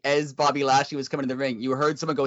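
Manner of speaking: ordinary talk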